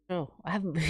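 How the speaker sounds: ordinary delivery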